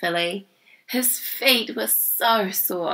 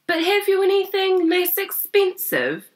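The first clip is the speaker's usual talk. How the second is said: much higher, strained voice; anxious asking; 12 semitones higher than usual. A narrator's reading. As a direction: ask it high and worried. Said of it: "interrupted Grandpa".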